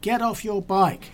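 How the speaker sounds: half-strength shouted command, drawn out; retold shout. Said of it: "he shouted at me".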